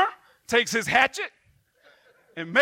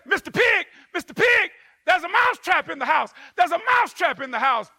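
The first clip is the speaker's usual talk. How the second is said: Mister Pig pitched up high in alarm; frantic calling; 6 semitones higher, faster than usual. Acted.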